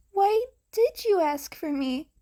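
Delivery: high and tender, eager; high hero voice, earnest. Acted. Gentle question.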